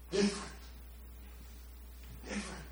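very soft, fading away; hushed. Real, not acted. Pastor reflecting on George's change, hushed and fading. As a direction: let it drop to almost nothing. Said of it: "fading repetition".

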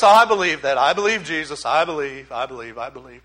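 dismissive shutdown. Retold or imitated. A listener shutting down talk of Jesus, fading away. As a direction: brush off the preacher, trailing into silence. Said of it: "They're shutting you down".

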